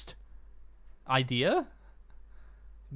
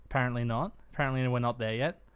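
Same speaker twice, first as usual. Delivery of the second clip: usual speaking voice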